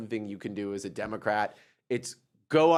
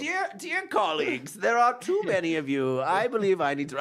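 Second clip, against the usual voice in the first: high, prim politician voice; Alyssa Slotkin voice; 5 semitones higher than usual. Retold or imitated. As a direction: play a stiff, formal senator. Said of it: "that's my Alyssa Slotkin voice".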